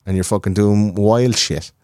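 ordinary talk, nothing marked

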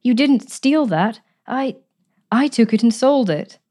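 a touch of agony, slow; anguished confession. Acted. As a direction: confess it in pain. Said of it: "in a voice of agony".

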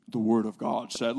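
loud, deep audiobook narrator; big, low, grand reader voice; awesome Bible-reader voice. Acted. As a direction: boom it out low like a recorded scripture reader. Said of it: "the reader has an awesome voice".

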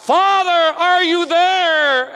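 high, loud, slow plea; crying out. Retold or imitated. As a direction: cry out to the Father, stretching the words. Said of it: "we cry out".

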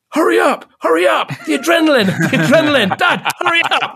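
put-on high-pitched, pleading young son; high, urgent mimicry of an impatient kid; urgent child. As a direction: imitate a frantic boy hurrying his dad. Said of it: "turns around to me and goes".

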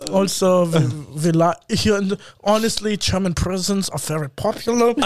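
mock German prison promoter; comic German-accented English. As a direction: explain earnestly in a put-on German accent. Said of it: "your German accent".

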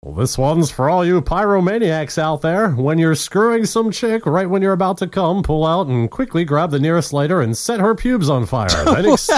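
high, theatrical reading of a crude definition; hammed-up announcer read. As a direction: read it out like a showy presenter. Reads out joke definition.

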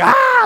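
sharp high mock scream; a short high squeal of shock; retold scream. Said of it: "she starts screaming and hollering".

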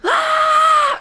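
piercing, very high, loud shriek; scream. Acted. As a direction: scream the monster away. Kid screams at monster.

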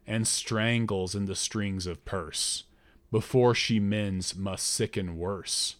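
slow, solemn oracle voice; voice of a god. Acted. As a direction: intone it like a god through the priestess. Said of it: "in the voice of a god".

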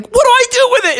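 very high squeaky frog voice; panicked Froggy. Acted. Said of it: "And Froggy was like".

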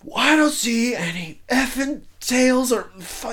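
high, slow, fed-up complaint; exasperated outburst. Retold or imitated. [name] fed up with the crew, high slow exasperated complaint. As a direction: lose patience with them, dragging it out. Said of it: "finally I'm like".

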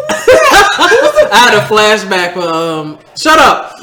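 laughing, slowed and loud. Real, not acted. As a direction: laugh through it, high. Laughing after flashback.

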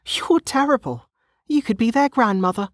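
put-on very high, scandalized young woman; flustered protest. A narrator's reading. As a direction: protest in a high, embarrassed character voice.

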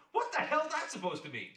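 loud, high, alarmed shout; shocked outburst. Acted. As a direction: blurt it out in disbelief. Husband shocked by talking device.

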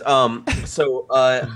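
usual speaking voice

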